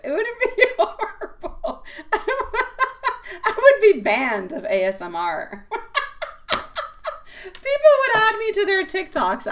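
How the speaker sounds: giggling through the words; laughing. Real, not acted. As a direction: laugh while talking. Laughing at own joke.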